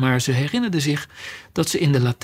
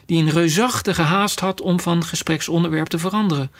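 ordinary delivery

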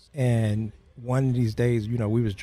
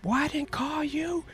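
high put-on dad warning; very high mock stern dad; threatening father. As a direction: warn the boy off like an exasperated father. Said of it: "He said".

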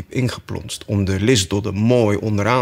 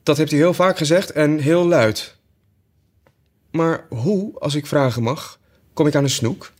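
put-on lightly raised girl's voice; Alice; 6 semitones higher than usual. Alice answers the sheep, lightly girlish. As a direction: answer brightly as young Alice.

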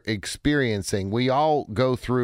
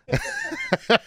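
squeaky cracked yelp. Retold or imitated. Voice breaks on snap count.

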